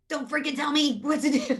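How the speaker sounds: high, loud mock outburst at a husband; exasperated snap. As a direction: act out bristling at being told what to do. Acts out snapping at her husband.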